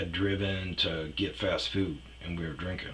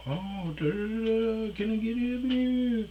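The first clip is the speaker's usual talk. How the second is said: very high, squeaky mock concern; over-solicitous mock fussing; 4 dB louder, slower than usual. Boyfriend at first fussing over her panic attacks, mock-doting.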